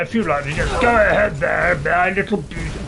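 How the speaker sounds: a hint of a sneering villain; lightly voiced dark sorcerer; necromancer voice. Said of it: "changing my voice by the minute here".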